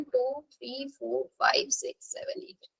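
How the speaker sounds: very soft counting, fading almost to nothing; counting under breath. Real, not acted. Teacher checking the octet is complete, counting under breath. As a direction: let the count sink into near-silence.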